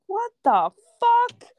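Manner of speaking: high, loud, drawn-out disbelief; shocked outburst. Retold or imitated. Retelling her reaction to being bitten by the dog, shocked outburst. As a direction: let each word land high and loud.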